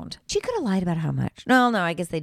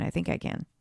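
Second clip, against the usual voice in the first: fading whisper; 3 semitones lower, 10 dB quieter than usual. Shown as a demonstration.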